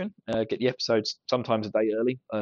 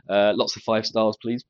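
usual speaking voice

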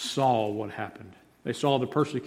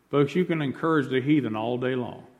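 ordinary talk, nothing marked

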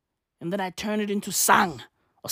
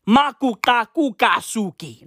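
mock foreign name; 3 semitones higher than usual. Imagined wild name.

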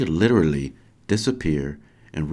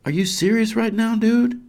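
very high, incredulous cry; excited scream; 4 dB louder than usual. Acted. Parent to boy who just teleported, screaming. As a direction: shriek it in disbelief, half-restrained. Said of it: "I scream and, and jump".

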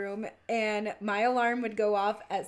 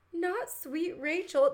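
mock disbelief; 7 semitones higher, slower than usual. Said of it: "you know what he said".

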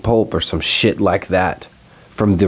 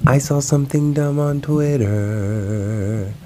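slow, drawn-out, higher announcer read; segment title call; 6 semitones higher, 3 dB quieter, slower than usual. Acted. Vlogger announcing his recurring segment title to viewers, slow and drawn out. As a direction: announce the segment like a show host.

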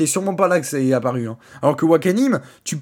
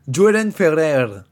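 ordinary delivery

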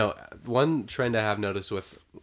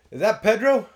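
high, loud, blustery uncle; Uncle Rico voice; 11 semitones higher, 7 dB louder than usual. Acted. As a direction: bark it down like Uncle Rico from the house. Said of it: "my Uncle Rico voice is pretty good".

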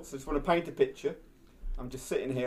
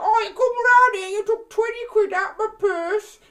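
imitating the loud neighbour down the road, much higher and louder; 10 dB louder than usual.

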